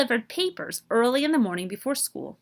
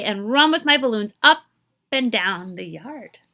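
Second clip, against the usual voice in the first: ordinary delivery, nothing marked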